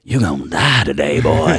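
playful villain threat; mock menacing threat. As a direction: taunt like a cartoon villain. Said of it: "and I'm just like".

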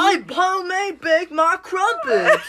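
put-on high, slow British accent gone wrong; botched British accent. Boasting about crumpets, badly botched British accent. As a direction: let the British impression slide into something unplaceable. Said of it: "went from British to, like, something else".

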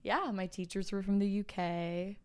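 ordinary delivery

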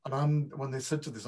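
ordinary talk

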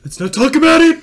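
high loud defensive yell; Crocker rant. Pretend. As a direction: snap back, refusing to discuss. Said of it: "Mister Crocker?".

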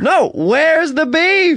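put-on high, very slow drawn-out old woman; slow old lady catchphrase. Shown as a demonstration. Director demonstrating the catchphrase to the actor, high and drawn out. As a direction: stretch each word like a crotchety granny.

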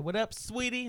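imitating a friend's playful high greeting; sassy girl voice.

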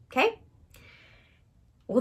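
ordinary talk, nothing marked

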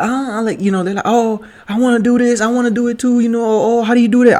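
high, excited, gushing fan voice; mock-eager people asking about the pictures; imitating eager people. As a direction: gush like someone who wants the same photos. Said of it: "people could be like".

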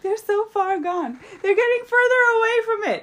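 very high, giggling through it; squeaky stifled laughing; laughing whisper. Retold or imitated. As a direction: hold in the laughter and fail. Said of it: "she was like".

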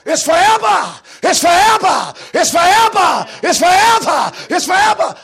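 high, full-voiced repeated scream. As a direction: cry it out like doomed creatures. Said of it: "they screaming out".

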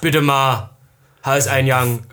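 put-on broad Halle dialect; demonstrating thick Halle dialect. Shown as a demonstration. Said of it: "mit ihm Dialekt reden".

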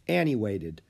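ordinary delivery, nothing marked

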